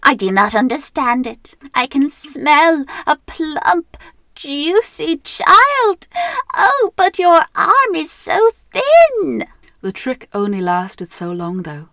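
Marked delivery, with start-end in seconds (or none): 0.0-9.5 high, slow moaning witch voice. Acted. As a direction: moan it like a greedy witch. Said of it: "the witch would moan".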